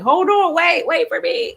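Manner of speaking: high, playful call after her soul; a high mock plea to wait; a light high-pitched "wait for me"; mock body calling. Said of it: "my body just left here like".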